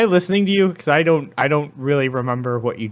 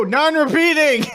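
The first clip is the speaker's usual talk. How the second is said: exasperated; 11 semitones higher than usual. Real, not acted. Exasperated correction in argument.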